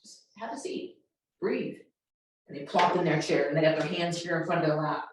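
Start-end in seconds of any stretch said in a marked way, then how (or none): 0.0-1.8 barely voiced, gentle invitation to sit and breathe; soft hush to settle a kid; quiet, slow, low. Shown as a demonstration. Teacher calming a kid out of detention, hushed. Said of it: "how quiet it is, how slow it is".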